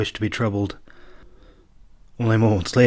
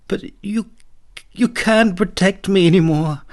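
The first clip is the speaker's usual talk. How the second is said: tearful princess; 10 semitones higher, 5 dB louder than usual. A narrator's reading. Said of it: "tears began to run down her face".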